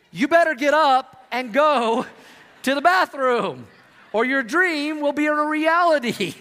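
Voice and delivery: loud, high, slow mock urgency; urgent mock warning. Pastor warning the congregation, loud and slow. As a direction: warn them loudly and slowly, half joking.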